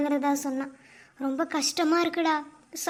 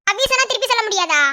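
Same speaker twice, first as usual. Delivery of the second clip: louder, fast, higher indignant protest; indignant demand; 5 semitones higher, 8 dB louder, faster than usual. Acted. Wife scolding husband for not saying it back, loud fast indignant. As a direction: snap it back at him, offended.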